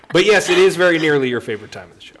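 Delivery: loud announcer lift; announcer boost. Acted. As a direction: boom it out like a show host. Show host announces segment.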